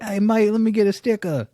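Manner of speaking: high, loud mock British accent; hammed-up British "mate" voice. Quotes a UK sticker requester.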